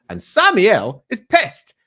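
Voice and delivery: usual speaking voice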